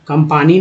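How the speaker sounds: कंपानी with long 'aa', kum-paa-nee; wrong long-aa vowel. Shown as a demonstration. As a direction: stretch the middle of कंपानी to 'paa'. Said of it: "नहीं बोल रहे".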